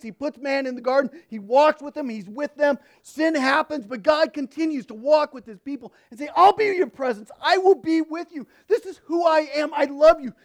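loud, high, impassioned pulpit voice; preaching at full force. Acted. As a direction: preach it out to the congregation. Preacher at sermon climax.

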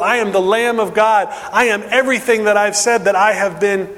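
preaching at full force. Acted. Preacher voicing Jesus' warning to the crowd, emphatic. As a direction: proclaim each line like a verdict.

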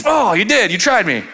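high mock cry of surprise; stunned comeuppance. Cocky teen who just got slapped, mock stunned cry. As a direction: yelp it as if just smacked.

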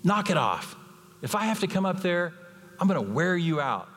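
put-on upset dad warning the boy; mock angry dad. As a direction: scold like an exasperated father. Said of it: "Now the dad got upset".

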